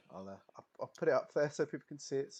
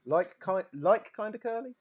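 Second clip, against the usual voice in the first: Brummie accent; 6 semitones higher, 5 dB louder than usual. Shown as a demonstration. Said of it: "I can't do that bit in Birmingham".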